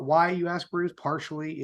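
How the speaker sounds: usual speaking voice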